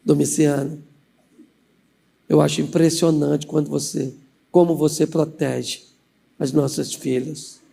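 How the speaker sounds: low, soft flattery, slowing toward the end; sweet coaxing. Acted. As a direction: flatter a stern husband gently. Aunt flatters husband.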